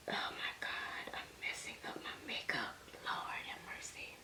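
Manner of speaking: very faint, voiceless whisper to yourself; real choked-up whispering; tearful whisper. Real, not acted. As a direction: whisper it, overcome and fretting. Tearful, fretting over ruined makeup.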